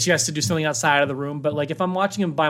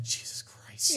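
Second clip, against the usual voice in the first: breathless whispered Jesus Christ; whispered aside; 12 dB quieter, much slower than usual. Retold or imitated. Dad overwhelmed by his son's goodbye, whispering. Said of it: "I was like".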